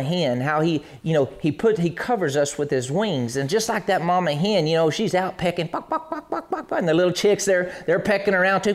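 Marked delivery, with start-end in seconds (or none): 5.7-6.8 high, soft clucking buck, buck, buck; quiet high hen clucks. Retold or imitated. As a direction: cluck softly like a pecking hen. Imitating a pecking hen.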